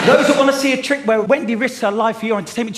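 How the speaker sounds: ordinary talk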